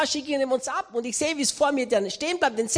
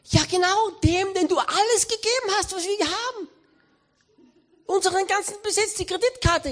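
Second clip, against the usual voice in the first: higher wife voice; Melinda Gates; 8 semitones higher than usual. Retold or imitated. Melinda reminding Bill he gave everything away, higher voice. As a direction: reproach him lightly as his wife.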